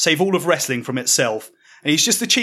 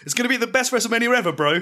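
high, fast excited exclaim; 7 semitones higher, faster than usual. Acted. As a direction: gush it out, eager. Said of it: "you exclaim".

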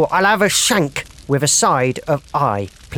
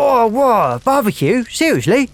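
amazed; 7 semitones higher than usual. Retold or imitated. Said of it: "and he's like".

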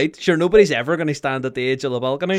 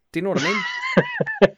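laughing. Real, not acted. Laughing at wardrobe joke.

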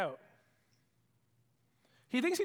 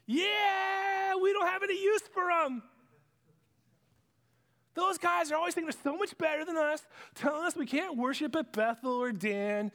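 gloating cheer; 9 semitones higher than usual. Acted. Crowd cheering Judah's doom.

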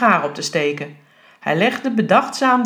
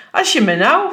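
higher-pitched, indignant burst; exasperated teacher cutting in; indignant outburst; 6 semitones higher, 4 dB louder than usual. A narrator's reading. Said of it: "viel juffrouw Veldman uit".

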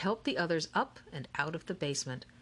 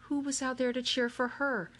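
plain reading voice

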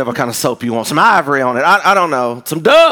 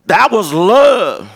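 put-on a fifteen-year-old insisting slowly it was love; teen heartbreak; 5 semitones higher, 6 dB louder, much slower than usual. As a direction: play the lovesick teenager, drawn out. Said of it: "I'm fifteen".

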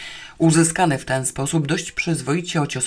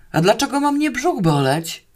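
high, incredulous question; astonished bellow; 8 semitones higher than usual. A narrator's reading. Watchman puzzled by a question about his belly, astonished bellow. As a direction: ask in raised-pitch astonishment. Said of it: "zdziwił się potężnym rykiem".

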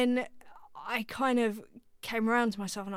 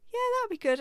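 nervous squeak; 13 semitones higher, 4 dB louder, much faster than usual. Retold or imitated. Nervous reply to producer.

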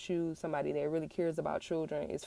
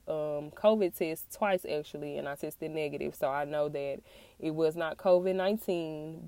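usual speaking voice

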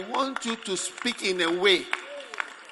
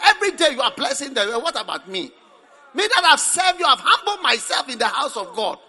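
bitter prayer; 9 semitones higher, 9 dB louder than usual. Retold or imitated. Bitter believer shouting through tears at God. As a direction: accuse God through tears, rising to a wail. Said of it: "you can pray bitterness".

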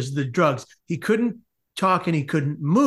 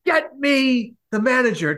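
higher-pitched, indignant demand; freaking-out father; 6 semitones higher than usual. Retold or imitated. Father to the lady at the front desk, freaking out. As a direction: demand it like an outraged customer. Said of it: "he's just freaking out".

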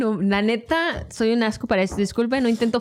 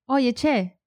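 put-on lightly done Argentine accent on Oye, che; a hint of Argentine accent, casual call; 3 semitones higher than usual. Reading an accent card aloud, in a light Argentine accent. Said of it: "con esta sola se lo van a saber".

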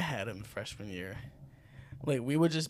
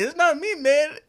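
high, slightly louder denial; mock protest; 10 semitones higher, 5 dB louder than usual. Shiva denying to the teacher, high indignant denial. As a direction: protest your innocence. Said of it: "Shiva was like".

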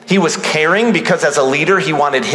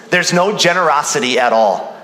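ordinary talk, nothing marked

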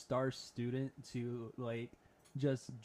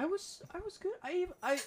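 squeaky defense. Real, not acted. Defending his bass skill.

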